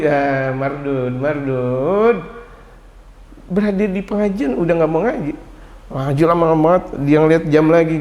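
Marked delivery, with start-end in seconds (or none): 0.0-2.2 slow verdict. Real, not acted. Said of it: "preacher's verdict, drawn out".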